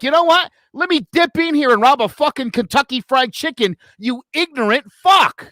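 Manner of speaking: mock the idea, drag out the insult; sarcastic. Driver to cop suspecting KFC robbery, sarcastic.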